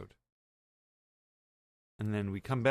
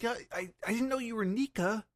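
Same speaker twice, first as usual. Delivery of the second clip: put-on much higher squeaky kid voice; Bonnie's voice; 12 semitones higher than usual. Retelling the episode, doing Bonnie's high kid voice. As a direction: pipe it up like a little girl. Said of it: "Bonnie's like".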